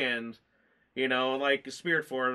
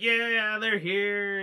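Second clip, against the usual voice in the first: raised, lifted cheer of excitement; excited cheer; 7 semitones higher, 4 dB louder, much slower than usual. Retold or imitated. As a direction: relive cheering at the screen. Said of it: "the cheering moment, super excited".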